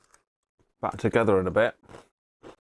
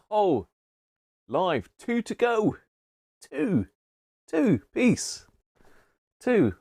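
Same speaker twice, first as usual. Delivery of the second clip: excited; 10 semitones higher, slower than usual. Real, not acted. Counting down subs excitedly.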